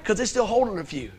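ordinary talk, nothing marked

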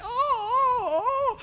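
high wailing mock cry; hammed-up crying oh oh oh; imitating crying. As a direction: wail like the hurt teacher. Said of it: "And she".

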